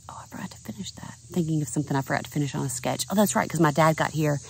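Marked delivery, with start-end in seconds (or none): 0.1-1.1 quick, soft whisper to herself; a sudden thought, whispered fast; whispered aside. Real, not acted. Muttering a sudden memory to herself.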